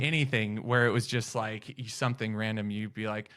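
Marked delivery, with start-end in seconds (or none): none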